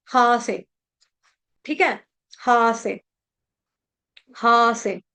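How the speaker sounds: "हा" long, letting the breath out; visarga breath release. Shown as a demonstration. Teacher showing how to release breath, long "हा". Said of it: "सांस कैसे छोड़ना है".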